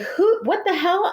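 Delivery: high, slow shocked cry; startled outcry. Retold or imitated. Someone yanks her sash from behind, startled high cry. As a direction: yelp it out as if grabbed from behind.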